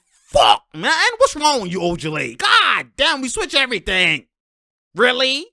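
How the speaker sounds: high, strained mock coach cursing his player out; furious coach. Coach Brad Stevens cursing at Ojale over the blown switch, exasperated. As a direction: pitch way up, fed up and disbelieving. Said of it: "He's like".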